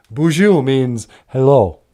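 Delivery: normal talking voice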